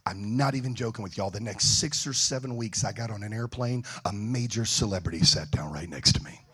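usual speaking voice

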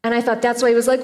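normal talking voice

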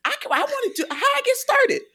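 very high-pitched, laughing amazement; hammed-up wide-eyed eagerness, voice shooting way up; giddy excitement. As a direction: act out an eager kid's squealed discovery. Acting out younger self's eager discovery.

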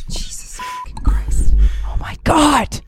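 exasperated whisper under the breath; whispered curse. Real, not acted. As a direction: hiss the curse in frustration. Frustrated with the microphone.

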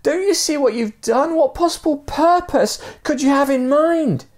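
very high-pitched, exasperated shouting; high, indignant storyteller's shout; acted shout. A narrator's reading. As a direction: shout questions down at the man below. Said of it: "looked down and shouted to the guy".

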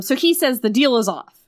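usual speaking voice

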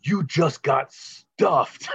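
slow, drawn-out mock triumphant yell; hammed-up movie villain one-liner.

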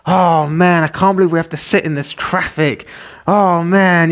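mock whiny exasperation; imitated complaining driver. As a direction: imitate someone griping about a traffic jam. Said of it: "you can hear someone in their car going".

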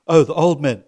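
usual speaking voice

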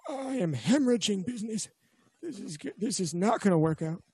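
dismayed shopkeeper thinking aloud; lightly voiced despairing merchant; inner voice of shopkeeper. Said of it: "in his mind".

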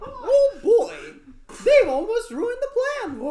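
high squeaky cartoon mouse; Mickey Mouse voice.

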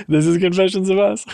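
high, loud, hammed-up Boston accent; terrible Boston accent. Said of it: "my terrible Boston accent".

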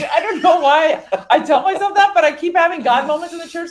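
high, loud, through laughter; laughing exasperation. Real, not acted. Admitting a puzzling habit to friends, laughing exasperation. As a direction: laugh at yourself in baffled exasperation.

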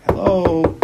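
the soul shouts "Hello." to wake you; neshama calling out. Acted. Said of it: "the neshama's like".

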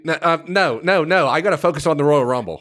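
rising panicky refusals; flustered panic. Acted. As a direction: get flustered and talk fast. Caller panicking, refusing offers.

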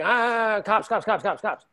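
imitated panicky babble, starting high; play-acted fright, high then dropping; mock panic. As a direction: blurt it in mock alarm, rapid-fire repeats. Imitates runner fleeing from police.

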